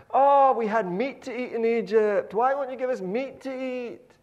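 high, loud petulant whine; whining Israelites. Retold or imitated. Israelites whining to Moses for meat, high and loud.

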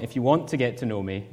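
ordinary delivery

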